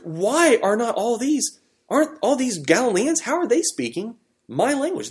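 high, incredulous astonishment, partly retold; a hint of stunned bystanders; amazed crowd. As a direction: voice the crowd's mock disbelief. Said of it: "they were all amazed and astonished".

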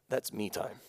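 soft mock self-indulgent voice; believer's hushed excuse; mock selfish whisper. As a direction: murmur it like a guilty secret.

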